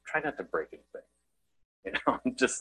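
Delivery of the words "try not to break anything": low, quiet weary exasperation; low, understated exasperated aside. Acted. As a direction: imitate a long-suffering parent, very softly. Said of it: "is exasperated, shakes his head".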